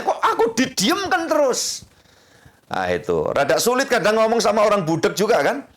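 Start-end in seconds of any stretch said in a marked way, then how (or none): 0.0-1.8 high-pitched mock grievance; put-on wounded protest, pitched up; aggrieved complainer. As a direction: play someone sulking at being ignored. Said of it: "orang diem katanya".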